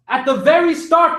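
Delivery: high, loud call of the congregation; cried out. A narrator's reading. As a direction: cry it out like a sermon. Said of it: "they cried".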